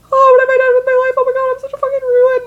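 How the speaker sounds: imitating her teenage self sobbing; mock sobbing wail. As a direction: wail it like a breakdown in the ambulance. Retold sobbing breakdown.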